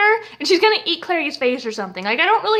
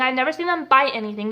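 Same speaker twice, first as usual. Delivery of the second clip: usual speaking voice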